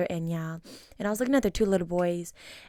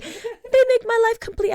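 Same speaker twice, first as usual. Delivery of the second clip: gushing; 6 dB louder than usual. Retold or imitated. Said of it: "I'm like".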